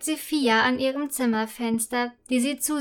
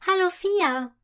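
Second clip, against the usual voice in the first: high, friendly call to a friend below; 6 semitones higher than usual. Acted. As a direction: call down across the courtyard. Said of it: "ruft Prinzessin Friederike".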